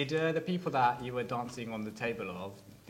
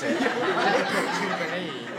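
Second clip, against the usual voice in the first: laughing; 6 semitones higher, 8 dB louder, much slower than usual. Real, not acted. Laughs mid-sentence at own claim.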